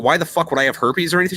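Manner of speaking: usual speaking voice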